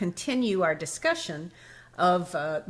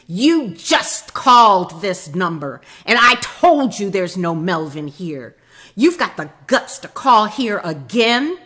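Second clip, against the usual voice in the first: slow, louder, irritated phone rebuke; heated reply; 8 dB louder, slower than usual. Retold or imitated. Man scolding a repeat wrong-number caller, heated. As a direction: scold the caller slowly and firmly. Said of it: "came the heated reply".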